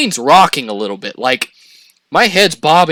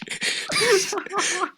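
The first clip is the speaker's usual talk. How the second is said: high laugh; 9 dB quieter than usual. Real, not acted. Reacting to girl's nails comment.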